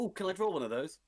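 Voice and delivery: eager ask. Real, not acted. Excited player asks for card.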